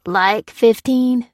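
slow, disbelieving "fifteen" stretched out; incredulous echo. A narrator's reading. Dufflecoat echoing Alphonse's number in slow disbelief. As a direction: repeat "Like fifteen?" in disbelief, drawing out fifteen. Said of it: "said Dufflecoat".

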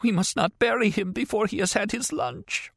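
high frail broken plea; distraught old woman. Acted. Woman begs them not to bury him, high and frail.

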